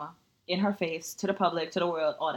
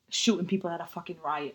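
normal talking voice

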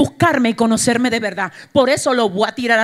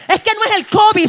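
higher and louder, preaching climax; building shout; 9 semitones higher, 6 dB louder, faster than usual. Shown as a demonstration. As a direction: drive covid home with full force. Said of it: "repeats it stronger".

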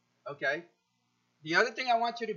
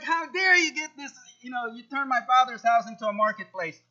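raised, louder indignant rebuke; higher, louder cry of indignation at the temple sellers; indignant Jesus; 4 semitones higher, 4 dB louder than usual. Retold or imitated. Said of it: "He was indignant".

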